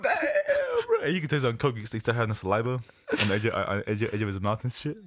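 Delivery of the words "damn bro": very high, whiny "Damn, bro"; mock wail. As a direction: whine it in disbelief. Stunned reaction, cry-like, very high.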